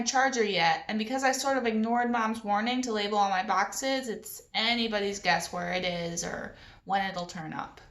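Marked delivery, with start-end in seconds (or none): none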